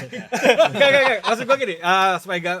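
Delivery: high, loud hurried denial; loud protest. Real, not acted. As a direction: cut in high and loud to clarify. Buru-buru meluruskan maksud saat ditertawakan. (menyangkal keras dengan nada tinggi)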